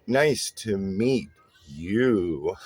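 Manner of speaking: low, drawn-out creepy vampire voice on "Nice to meet you"; scary greeting. Shown as a demonstration. Teacher mocking a greeting that scares people off, creepy drawn-out voice. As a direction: stretch "Nice to meet you" slowly and low, with a long sinister YOU. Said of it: "scare 'em off and say".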